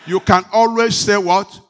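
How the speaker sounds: calling for a loud answer; preacher's loud call to the congregation. Real, not acted. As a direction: throw the question out loud to the crowd. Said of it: "Can I hear a big amen?".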